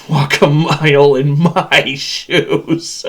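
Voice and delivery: slow, loud, collapsing into laughter; mirthful gloating. Acted. Machine taunts captive about suffering, gloating laugh. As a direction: stretch the phrase until laughter takes over.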